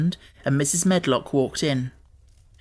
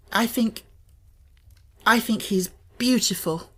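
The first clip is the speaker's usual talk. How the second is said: determined; 7 semitones higher than usual. A narrator's reading. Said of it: "in a determined voice".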